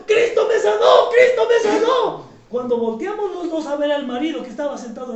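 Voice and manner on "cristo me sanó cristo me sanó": high, loud jubilant cry, second line slower; joyful shout. Retold or imitated. Healed woman leaping in the clinic, high joyful shout. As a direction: jump and shout your praise.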